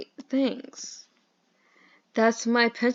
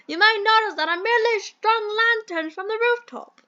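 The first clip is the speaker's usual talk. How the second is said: bad British accent; 9 semitones higher than usual. Mentor explains the electricity, over-the-top British accent. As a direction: explain like a hammed-up British chap. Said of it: "do the worst British accent".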